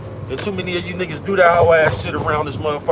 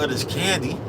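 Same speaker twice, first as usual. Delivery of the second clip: normal talking voice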